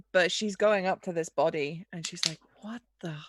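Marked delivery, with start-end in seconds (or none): 2.6-3.2 soft, under-the-breath shock; hushed disbelief. Acted. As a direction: say it much softer, stunned by the body. Hyde reacting to the body.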